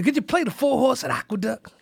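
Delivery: high-pitched grandma request; high, serious old-lady voice; dead-serious grandmother. Retold or imitated. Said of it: "And she was dead serious".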